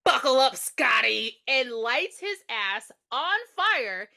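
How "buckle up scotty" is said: loud retold taunt; acted killer's call.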